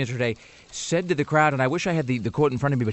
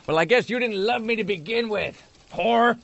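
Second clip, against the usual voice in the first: high mock bitter sneer; snarky breakup jab; 10 semitones higher than usual. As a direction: sneer it like a jilted ex. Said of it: "your girlfriend".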